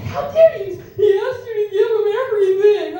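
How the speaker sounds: very high, loud mock wail; mock outrage. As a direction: squeal it in over-the-top indignation. Acted outrage at brother's betrayal.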